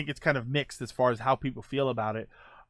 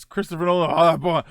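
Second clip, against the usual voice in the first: ordinary talk, nothing marked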